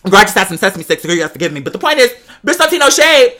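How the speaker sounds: usual speaking voice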